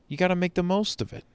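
normal talking voice

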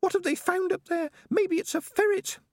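very high-pitched, worried rat; Peaches the rat. A narrator's reading. Rat guessing at the danger ahead, high and worried. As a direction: wonder anxiously in a tiny high voice.

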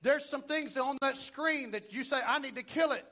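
rising preacher's fervor; preaching. Acted. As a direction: preach it with building force. Preaching at full force.

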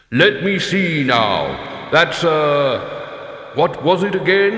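very slow, loud and ponderous; heavy leaden voice. A narrator's reading. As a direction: ponder aloud in a booming, leaden voice. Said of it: "hitting a rock with a roll of sheet lead".

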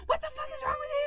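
very high crying shout; panicked wife screaming at her husband. Retold or imitated. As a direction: shriek it at him in tears. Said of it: "and I'm like".

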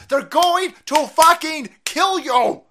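loud, high, slow and hammered out; furious warning. Real, not acted. Host warns the audience about leaders, furious warning. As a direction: drive every word home angrily.

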